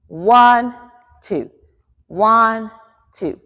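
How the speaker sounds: lifted one, dropped two; rhythmic dance count. Shown as a demonstration. Teacher counts the turn down and back, in time. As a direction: count two alternating beats, evenly spaced.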